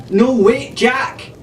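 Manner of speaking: high, urgent cry; high girl's voice calling out; cried out. A narrator's reading. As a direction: call him back, high and sharp. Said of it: "Arabella cried".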